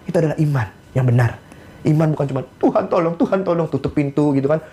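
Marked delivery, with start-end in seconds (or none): 2.6-3.7 higher-pitched imitation of a helpless prayer; mock pleading. Mocks passive believer's pleading prayer.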